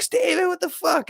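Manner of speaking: imitated high, drawn-out exclamation; startled outburst. Girl catching Steven in the locker room, startled. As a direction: mimic her startled, high-pitched reaction.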